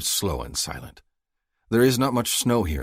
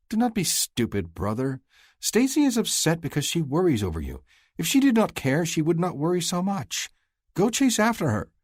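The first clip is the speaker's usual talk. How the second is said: higher, grinning sister's voice, bossy; teasing sister Farley; 11 semitones higher, 3 dB louder than usual. Acted. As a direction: play the cheeky younger sister nudging her brother. Farley scolds her brother.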